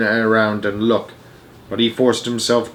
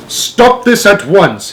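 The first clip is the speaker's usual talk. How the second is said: slow, loud, higher commanding; stern order; 9 semitones higher, 6 dB louder, slower than usual. A narrator's reading. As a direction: bring them to order firmly. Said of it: "he ordered".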